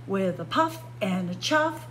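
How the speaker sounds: usual speaking voice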